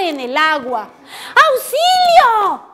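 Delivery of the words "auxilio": high, drawn-out storybook call for help; acted distress call, raised pitch without full volume; cry for help. A narrator's reading. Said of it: "gritó Rayito".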